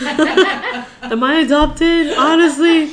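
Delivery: high, loud, drawn-out laughing question; mock indignation. Friend joining the joke about blaming parents, mock indignant. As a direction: stretch it out in joking disbelief.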